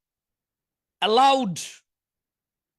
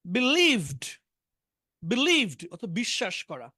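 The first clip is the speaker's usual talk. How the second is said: ordinary delivery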